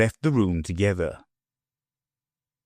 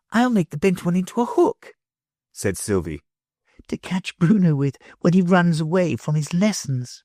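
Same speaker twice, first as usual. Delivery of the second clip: put-on higher, light girl's voice; little girl; 8 semitones higher than usual. A narrator's reading. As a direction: tease playfully. Sylvie voiced by reader.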